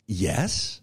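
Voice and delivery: ordinary talk, nothing marked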